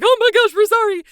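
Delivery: frightened pup caught out, yelping sorry; panicked dog voice. Pretend. Dogs scared by dad voice.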